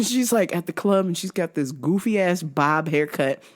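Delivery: ordinary talk, nothing marked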